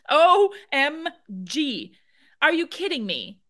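high, slow, stunned; outraged disbelief. Real, not acted. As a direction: react like you're appalled. Outraged reaction to the clip.

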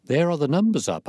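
high, eager announcement; excited cry. A narrator's reading. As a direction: call it out as news just spotted. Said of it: "I cried".

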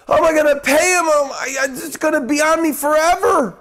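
lightly acted panic over the bills; mock worried. Voicing a worried patient.

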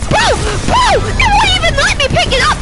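high, loud furious cursing; swearing tirade. Real, not acted. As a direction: rage at everyone. Swearing at enemies.

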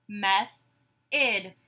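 "Method" split slowly in two; syllable by syllable. Shown as a demonstration. Teacher gives syllables to blend, "Method" broken apart. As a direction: say "Method" as meth, pause, od. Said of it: "I will say syllables in a word".

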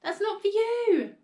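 high, loud frantic pleading; panicked plea, stretched out at the end; alarmed cry. A narrator's reading. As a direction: beg her urgently to stop. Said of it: "cried Missus Cranky".

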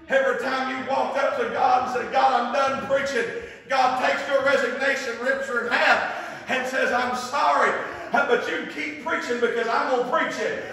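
loud, high revival preacher's cadence; full-force preaching. Real, not acted. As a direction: drive each phrase out with full fervor.

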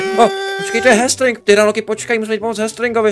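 excited. Acted. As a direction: exclaim on spotting Hastring. Finding Hastring. (vzrušeně)

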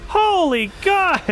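high, drawn-out exclamation; astonished outcry. Real, not acted. Player seeing an impossible jump, astonished cry. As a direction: gasp out stunned disbelief, slowly.

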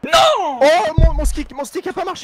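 high, loud cry of frustration; furious shout. Real, not acted. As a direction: yell at the broken stick. Controller fails mid-race.

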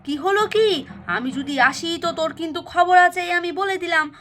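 cross mother calling out; scolding call. Acted. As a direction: warn the child sharply from afar. Mother calls up and threatens child.

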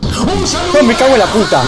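sudden frightened exclamation; startled cursing. Real, not acted. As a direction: curse as you jump in fright. Sudden monster appears.

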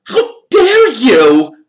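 high, loud, slow outraged shriek; shrieked how dare you. A narrator's reading. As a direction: scream it in outrage. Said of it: "Alicia shrieked".

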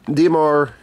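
ordinary delivery

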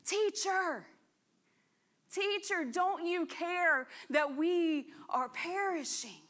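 high-pitched pleading call to the teacher; mock yell. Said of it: "So they yell".

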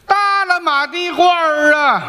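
slow ringing vendor call; hawker's cry. Acted. Apprentice copies the boss, a slow ringing hawker's cry. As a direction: belt it out like a market hawker. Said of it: "比你洪亮吧".

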